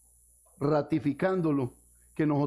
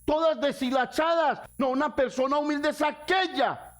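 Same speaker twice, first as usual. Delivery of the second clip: raised, fervent pulpit voice; preaching at full force; 10 semitones higher than usual. Acted. Pastor exhorting the church, fervent preaching. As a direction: preach it high and hard to the congregation. Preacher denouncing false humility.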